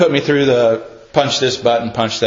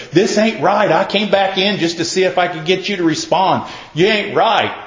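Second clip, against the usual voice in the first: higher-pitched retold protest; agitated challenge; 6 semitones higher than usual. Person returns to provoke the silent man, agitated challenge. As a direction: push it at them, insistent and high. Acts person baiting him to respond.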